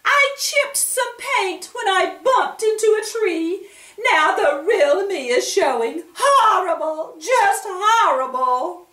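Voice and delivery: very high, loud sobbing wail; mayor's wife wailing about her nose, high and loud; hammed-up wailing. A narrator's reading. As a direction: sob it out dramatically. Wife wails over chipped paint.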